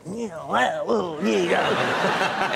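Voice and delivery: playful clown voice, hamming it up; leaning into the clown character. Retold or imitated. Walking past workers in clown face, hammed-up clown voice. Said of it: "be in character".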